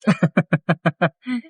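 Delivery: a short amused laugh; short real chuckle. Real, not acted. Driver laughing at child.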